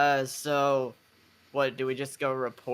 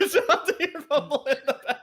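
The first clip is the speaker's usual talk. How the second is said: higher, repeated through laughter; laughing; 13 semitones higher, 4 dB louder than usual. Real, not acted. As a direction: echo the jab while cracking up.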